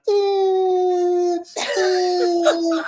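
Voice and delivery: high, whining reluctance; whiny hesitant voice. Retold or imitated. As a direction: whine it out hesitantly. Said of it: "you was like".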